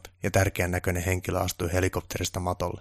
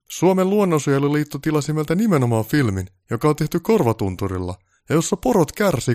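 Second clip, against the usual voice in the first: very high, loud outburst; indignant, overblown diva director; exasperated pompous director; 9 semitones higher, 8 dB louder than usual. Acted. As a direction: rant at the crew and demand more. Voices Baltasar exasperated, demanding drama.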